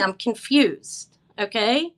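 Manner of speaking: drawn out slowly, very puzzled; slow bewildered. Real, not acted. Seller tells buyer she is lost, slowly. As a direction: stretch it out as if lost.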